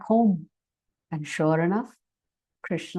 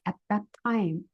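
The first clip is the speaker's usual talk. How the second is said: usual speaking voice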